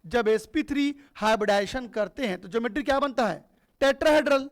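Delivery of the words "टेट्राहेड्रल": loud answer. Acted. Teacher calling out the answer for students, loud. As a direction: call out the answer loudly for the class.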